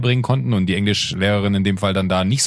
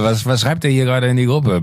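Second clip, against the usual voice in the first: higher, louder, distracted inner voice; inner voice of his brain; 4 semitones higher, 4 dB louder than usual. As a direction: voice your own busy brain. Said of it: "Wie mein Gehirn so".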